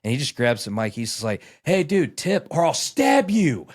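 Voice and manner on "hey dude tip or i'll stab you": high, loud, slow hammed-up mock threat over a mic; imitating a DJ's loud joking threat to the crowd.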